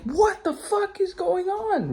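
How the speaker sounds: high-pitched mock scream; retold disbelief. Storyteller reenacting his reaction to the fire, bewildered. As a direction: squeal the question in alarm.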